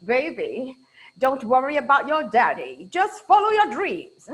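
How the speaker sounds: mild British accent imitating mom; soothing mum in a British accent. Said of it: "my mom has a British accent".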